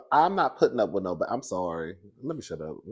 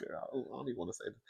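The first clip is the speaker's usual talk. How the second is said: secretive gossip whisper; 16 dB quieter, faster than usual. Real, not acted. Gossiping to viewers about the little boy, whispered. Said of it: "I don't know why I'm whispering".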